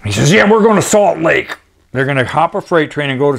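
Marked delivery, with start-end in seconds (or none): 0.3-1.6 higher-pitched, annoyed retold quote; pissed off. Bobby Kay announcing Salt Lake plans, pissed off. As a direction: imitate an irked tramp, pitched up. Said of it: "he's all pissed off".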